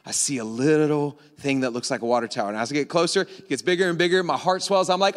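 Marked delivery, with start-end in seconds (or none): none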